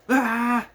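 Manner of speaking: mock sleep-scream, high and loud. Brother screaming in his sleep, imitated yell. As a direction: let out a sudden high yelp like a sleeper. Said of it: "they just start going".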